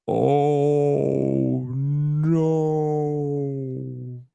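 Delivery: very drawn-out, sustained "oh no"; slow-motion dismay. Acted. Imagining dropping the trophy, slow-motion dismay. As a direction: stretch it out as if time has slowed. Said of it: "that's when time slows down".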